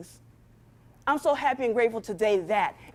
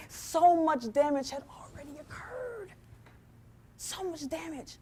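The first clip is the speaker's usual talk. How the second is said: hushed and heavy; 4 semitones higher, 6 dB quieter, slower than usual. Real, not acted. Recalling her last straw.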